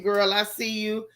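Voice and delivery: normal talking voice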